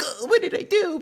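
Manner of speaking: very high, loud squeaky panic; panicked girlfriend. Retold or imitated. Streamer imitating his girlfriend hiding in the corner, squeaky panic. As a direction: squeak it in a frightened falsetto.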